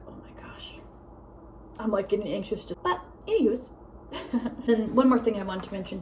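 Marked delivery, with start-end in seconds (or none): 0.1-0.8 breathy embarrassed whisper; whispered oh my gosh. Retold or imitated. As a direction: whisper it, cringing at yourself.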